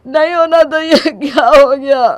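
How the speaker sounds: tearful wail. Acted. Nephew lamenting to his aunt, wailing loudly in tears. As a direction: let the grief burst out, slowing at the end. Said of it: "रोता हुआ विपुल आगे बढ़ता है".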